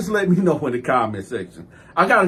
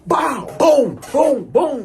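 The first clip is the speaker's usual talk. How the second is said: high, punchy spoken blow sounds; punchy "bow, boom, boom" sound effects; imitated punches; 10 semitones higher, 3 dB louder, much slower than usual. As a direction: voice each hit landing. Imitating blows landing.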